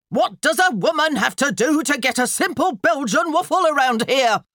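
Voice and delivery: high, loud outraged holler. A narrator's reading. Wife fed up waiting for waffles, hollering. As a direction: holler it across the house. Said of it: "Missus McCrumpet hollered".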